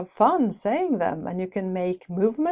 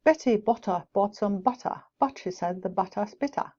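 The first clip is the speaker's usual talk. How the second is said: Betty Botter in a crisp British accent, clear T in "butter". Shown as a demonstration. Teacher demonstrating British pronunciation, British accent. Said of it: "difference between American and British".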